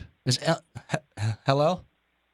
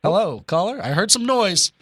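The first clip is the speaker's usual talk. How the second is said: raised voice, higher, calling into the phone; loud calling out; 6 semitones higher, 5 dB louder than usual. Acted. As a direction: call out loudly to a silent line. Shouting at silent caller.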